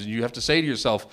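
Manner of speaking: normal talking voice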